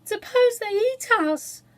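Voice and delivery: high, loud and slow, voice trembling; a frightened girl pleading to turn back; trembling, scared plea; trembling fear. A narrator's reading. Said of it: "her voice trembled a little".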